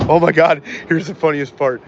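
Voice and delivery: ordinary talk, nothing marked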